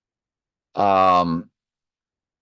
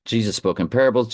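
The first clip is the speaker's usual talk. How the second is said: usual speaking voice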